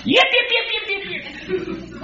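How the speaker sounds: high thin yaps like a little dog; squeaky little dog yips, soft; dog yipping. Retold or imitated. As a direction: yap like a tiny lapdog.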